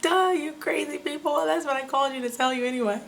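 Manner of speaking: high-pitched incredulous retort; mock exasperation. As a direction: toss it back as if it's obvious. Exasperated retort to hospital staff, retold.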